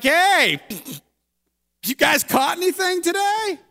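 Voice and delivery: a stranger hailing tired fishermen with a teasing shout; mock yell from shore. Stranger on the shore calls out to the fishermen, high mock yell. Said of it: "who's yelling out something like".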